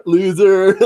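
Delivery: scornful loser jab; mocking. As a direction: sneer it like a bully. Mocking the heat-weak northerners.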